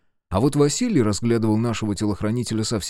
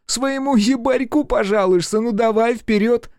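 high, slow sneering taunt; mocking; 12 semitones higher, 4 dB louder than usual. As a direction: goad her with contempt. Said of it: "насмешливым тоном спросил он".

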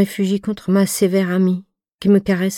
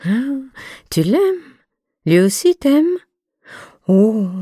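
much higher, amused and curious questions; a cheerful, surprised older friend; amused surprise; 7 semitones higher, slower than usual. A narrator's reading. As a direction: tease her, delighted by the news. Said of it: "égayait Margot".

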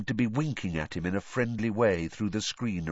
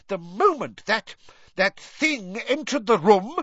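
high, distraught, furious cry; McGonagall screaming; 12 semitones higher, 4 dB louder than usual. A narrator's reading. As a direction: scream the accusation, stumbling on the words. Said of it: "she screamed".

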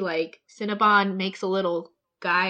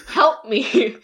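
louder, whimpering mock plea; mock pleading; 5 dB louder than usual. Snail begging everyone for help, mock pleading. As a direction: act out a tearful cry for help.

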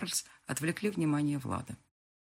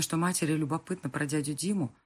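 ordinary delivery, nothing marked

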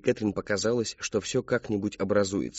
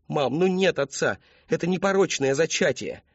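exasperated daughter; 7 semitones higher, 3 dB louder than usual. A narrator's reading. Daughter pleading with mum, high insistent voice. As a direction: insist in a raised young voice.

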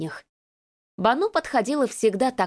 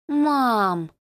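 drawn-out whine; 3 semitones higher than usual. Acted. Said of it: "протянула девушка".

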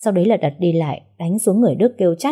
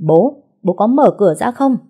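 higher, louder angry shout at the door; furious shout; 5 semitones higher, 7 dB louder than usual. Acted. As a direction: bellow it so the neighbours come out. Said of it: "Giọng của anh ta lớn tới mức hàng xóm cũng phải chạy ra nhìn".

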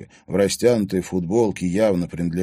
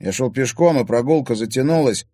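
higher-pitched, defensive explanation; making excuses; 6 semitones higher, 5 dB louder than usual. A narrator's reading. Timofey explaining his lateness, making excuses. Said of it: "принялся оправдываться Тимофей".

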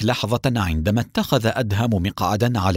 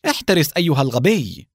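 higher, sharp warning cry; mildly raised, alarmed exclamation; startled cry; 5 semitones higher than usual. A narrator's reading. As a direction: cry out a warning while jumping clear. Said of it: "فصاحت وهي تقفز مبتعدة".

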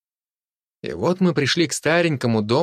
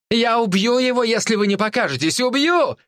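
shouting; 11 semitones higher than usual. A narrator's reading. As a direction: scream the threat at the hidden girls. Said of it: "крикнул он".

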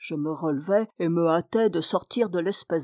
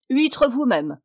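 louder, higher angry snap; furious retort; 4 semitones higher, 4 dB louder, slower than usual. Acted. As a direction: hurl it back at him in a rage. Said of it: "m'écriai-je, furieux". (réplique furieuse, plus forte et plus aiguë)

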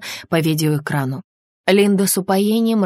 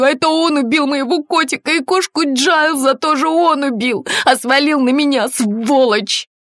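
high, loud, wailing tirade; hysterical screaming; 10 semitones higher, 5 dB louder than usual. A narrator's reading. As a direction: scream it out, beside yourself. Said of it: "внезапно закричала она". (истерический крик, высоко и громко)

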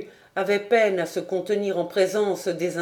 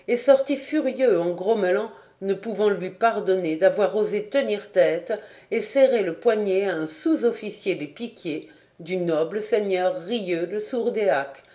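usual speaking voice

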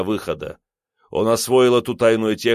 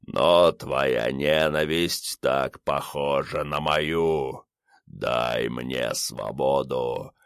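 deep, slow otherworldly rumble; dark lord; 6 semitones lower, 3 dB quieter, much slower than usual. A narrator's reading. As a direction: rumble it like a voice from the grave. Skull monster speaks.

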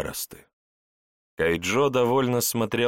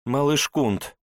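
high worried cry; anxious mother; 7 semitones higher, 4 dB louder, slower than usual. Acted. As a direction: call out to your hurt child. Mother finds wounded son.

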